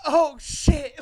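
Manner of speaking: high loud alarmed oh shit; frightened yelp; scared. Retold or imitated. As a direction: panic as the Jeep passes. Teens screaming at them.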